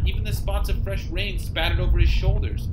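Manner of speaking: ordinary talk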